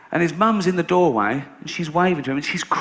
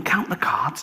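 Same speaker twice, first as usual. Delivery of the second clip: whispered instruction; 3 semitones higher, slower than usual. Retold or imitated. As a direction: breathe it so the dealer can't hear. Secret instruction at table.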